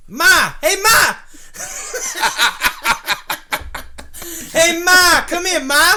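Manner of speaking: very loud high Boston holler; bellowed Boston Ma call; Boston yell. Retold or imitated. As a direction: shout for Ma across the house. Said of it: "How do they do it in Boston?".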